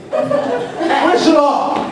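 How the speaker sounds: high, slow drawn-out call for Shalom; mother hollering for her son. Retold or imitated. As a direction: call him like a fed-up mother down the hall.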